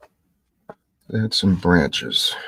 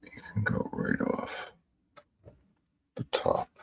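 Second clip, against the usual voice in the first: soft unvoiced whisper; 7 dB quieter than usual. Real, not acted. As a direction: murmur it while working. Murmured to self while painting.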